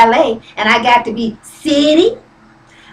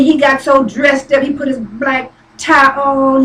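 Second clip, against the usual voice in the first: normal talking voice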